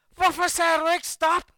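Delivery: loud, slow, angry question; angry demand. Retold or imitated. Speaker to the boy who failed to shout stop, loud and angry. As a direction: demand an answer from the lookout.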